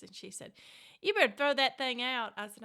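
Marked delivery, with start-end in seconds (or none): 1.0-2.3 loud, commanding mama voice; mama's stern order. Retold or imitated. Mother ordering her daughter to trash the photo, loud and stern.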